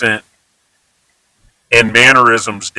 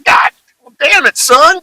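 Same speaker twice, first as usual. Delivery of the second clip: an angry country dad yelling, rising on damn; 14 semitones higher than usual. Retold or imitated. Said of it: "make 'em talk like a total hick".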